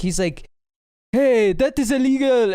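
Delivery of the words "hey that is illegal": lightly imitated South African accent; a hint of a South African accent. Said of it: "a South African accent he kept doing".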